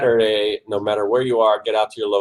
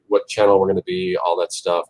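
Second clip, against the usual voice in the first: normal talking voice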